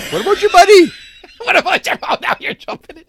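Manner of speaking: high-pitched, laughing words; helpless amused squeal of talk; laughing teasing. Real, not acted. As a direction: talk through real laughter. Joking reply while laughing.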